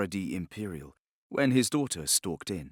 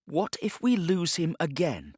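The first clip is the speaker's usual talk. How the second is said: higher, urgent challenge; urgent plea; 8 semitones higher than usual. A narrator's reading. Daughter confronting her father across his desk, higher and urgent. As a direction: throw the fear back at him. Daughter leans forward, fiery.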